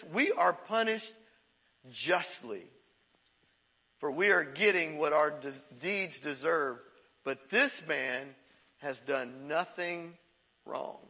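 slow, low and fading, grave; solemn slow confession. Retold or imitated. Repentant criminal rebuking the other, solemn and slow. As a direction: lay each word down slowly. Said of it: "He said".

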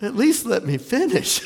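real laughter breaking into the words; amused, cracking up; laughing protest. Real, not acted. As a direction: plead through a laugh. Audience laughs at height joke.

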